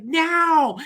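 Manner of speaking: high, loud imitated holler; retold urgent shout. Friends hurrying him over to the table, urgent shout. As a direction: act out an insistent call across a room.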